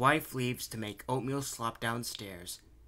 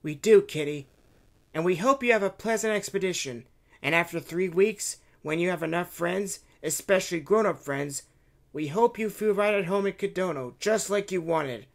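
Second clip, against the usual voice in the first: put-on a lightly raised child voice; 8 semitones higher, 8 dB louder than usual. Children bidding the princess farewell, lightly childlike. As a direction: speak up a little as a kid.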